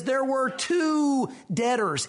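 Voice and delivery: "two" drawn out long and emphatic; stress on "two". Shown as a demonstration. Preacher reading scripture to make the point, emphatic "two". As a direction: lean hard and long on "two". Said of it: "It's emphatic in the language".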